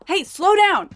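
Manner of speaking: loud call after someone. Acted. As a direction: shout to someone ahead. Calling after friend.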